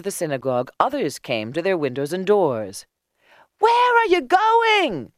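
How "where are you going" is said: very high, drawn-out call; high-pitched, loud question; curious crowd. A narrator's reading. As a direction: call slowly down to the street. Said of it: "they asked".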